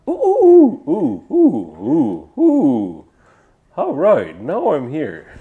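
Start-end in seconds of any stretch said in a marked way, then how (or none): 0.1-3.1 owl hoots. Acted. Owl clearing its voice, high hoots gradually dropping. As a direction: run of high hoots, stepping down in pitch. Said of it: "Let me just clear it up".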